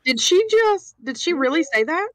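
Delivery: high disbelieving squeal; incredulous. Real, not acted. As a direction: ask in shocked disbelief. Player stunned by NPC's line.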